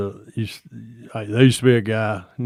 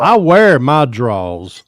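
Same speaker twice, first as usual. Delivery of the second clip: loud, slow Southern drawl; joking, stretched-out Southern drawl; 3 semitones higher, 9 dB louder, much slower than usual. Pretend. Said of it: "us in the South talk slow".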